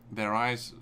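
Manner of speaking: ordinary delivery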